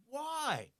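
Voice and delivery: high, loud, drawn-out "why"; puzzled exclamation. Acted. Acting out puzzlement at one miserable co-worker, baffled exclamation. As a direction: let it leap up in bafflement, then fall.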